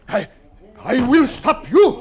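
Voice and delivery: slow, high desperate cry; desperate shout. Acted. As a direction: throw it out in desperation. Desperate last stand.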